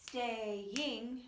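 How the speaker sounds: "Staying" drawn out in two slow beats; two syllables. Shown as a demonstration. Teacher counting syllables for learners, slow syllable-by-syllable delivery. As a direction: split "Staying" into its syllables, slowly. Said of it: "has two syllables".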